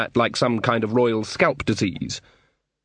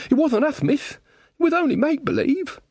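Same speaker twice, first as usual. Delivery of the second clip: very high, protesting villager; pleading excuse. Acted. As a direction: plead innocence in a squeaky-high voice. Villager pleads innocence to Magrat.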